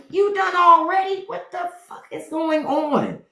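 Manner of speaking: as if she can't believe he's already done; shocked disbelief. Retold or imitated. Wife shocked husband already finished.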